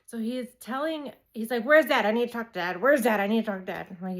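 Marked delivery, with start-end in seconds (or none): 1.6-3.9 loud, pressing demand for Dad; urgent excited kid. Retold or imitated. Son on FaceTime demanding his dad, loud and urgent. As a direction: blurt it out, can't wait.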